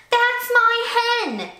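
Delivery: high, slow, loud protest; a loud high indignant cry; cried out. A narrator's reading. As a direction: claim her hen in outrage. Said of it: "she cried to the fisherman".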